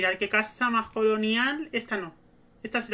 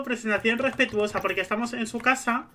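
normal talking voice

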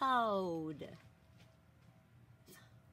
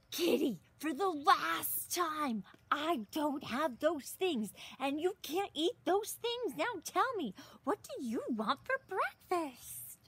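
higher, exasperated scolding; 8 semitones higher than usual. Acted. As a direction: lose patience with a silly cat. Exasperated parent to Kitty, last time.

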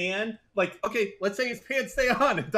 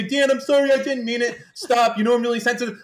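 a hint of a whiny apology, louder; mocking whine; 3 semitones higher, 6 dB louder than usual. Mimicking Mister Moon apologising to Dan, light mocking whine. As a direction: lightly mimic a hurt co-host. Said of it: "You say".

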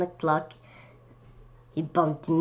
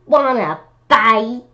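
grouchy challenge; 4 semitones higher, 7 dB louder than usual. Acted. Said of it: "said the grouchy ladybug".

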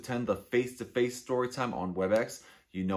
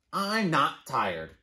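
stubborn bird voice; 5 semitones higher, 4 dB louder, much slower than usual. Acted. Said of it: "said Bird".

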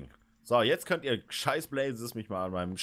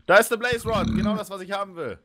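high, loud happy squeal; excited; 10 semitones higher, 4 dB louder than usual. Real, not acted. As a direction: squeal with delight over the loot. Spots the loot he wants.

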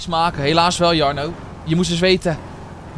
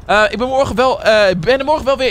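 loud message read-out; 5 semitones higher, 5 dB louder than usual. Real, not acted. Reading donor's message aloud.

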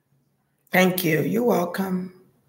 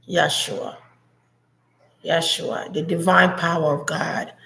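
channelling messages; 4 semitones lower than usual. Acted. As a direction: intone the words as if receiving them.

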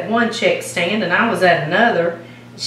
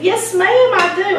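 excited; 10 semitones higher than usual. Retold or imitated. Excited reply to a fan.

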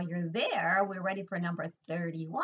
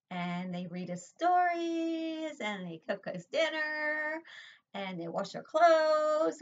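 sing-song listing; 8 semitones higher, 4 dB louder, slower than usual. Shown as a demonstration. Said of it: "listing mothers' kindness".

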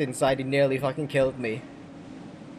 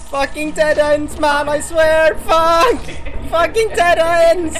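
furious shouting; 11 dB louder, slower than usual. Real, not acted. Raging at dead ends in game.

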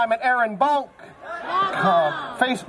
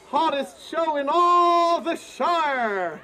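showman shout; 3 semitones higher, slower than usual. Acted. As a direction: sell it like a fairground barker. Performer hypes the fire show.

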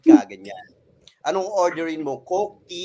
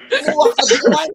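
high, slow, laughing through the command; laughing mock order; 5 dB louder than usual. Laughing dad ordering his child to fetch water. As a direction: order it while cracking up.

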